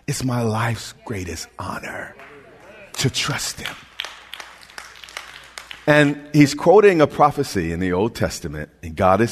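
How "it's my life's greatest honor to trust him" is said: soft whispered confession; hushed devotional whisper. Real, not acted. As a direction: whisper it like a secret honor. Intimate confession in sermon.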